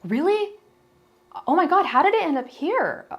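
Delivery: squeaky put-on innocence, imitating him; fake innocent surprise. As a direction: play the guilty ex pretending he has no idea. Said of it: "He'd be like".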